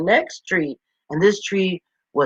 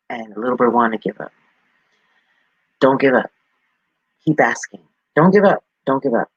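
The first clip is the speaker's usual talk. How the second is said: ordinary delivery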